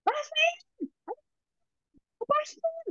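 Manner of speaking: imitating himself hollering for Pak Asmen; imitated yelling. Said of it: "teriak-teriak ya Pak Asmen".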